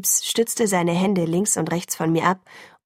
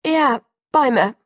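gasped out, higher and louder, slow; gasping; 6 semitones higher, 6 dB louder than usual. A narrator's reading. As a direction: catch your breath on the words. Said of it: "japste ich".

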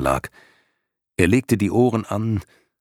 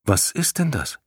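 put-on curious boy voice; 6 semitones higher than usual. Bastian asks, curious.

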